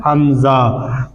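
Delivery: Hamza stretched very slowly with a falling tune; slow drawn-out answer. Shown as a demonstration. Teacher answering his own question slowly for the class. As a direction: drag out Hamza like spelling it for a child. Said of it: "क्या बन जाता है".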